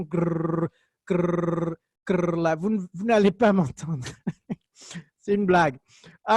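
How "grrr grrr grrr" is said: put-on rolled grrr like an old landline ringing; old phone ringing noise. Teacher mimicking an old landline for students, rolled grrr. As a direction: imitate the buzz of a house phone. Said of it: "les téléphones de maison là, qui font".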